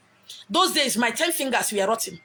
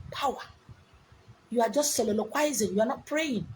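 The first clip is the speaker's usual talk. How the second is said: usual speaking voice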